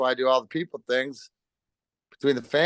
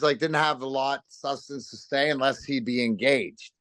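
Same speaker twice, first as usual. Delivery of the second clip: ordinary talk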